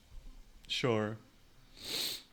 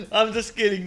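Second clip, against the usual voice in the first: loud, higher, laughing apology; 7 semitones higher, 7 dB louder than usual. Real, not acted. Streamer laughing while taking back the insult. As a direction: giggle the take-back out.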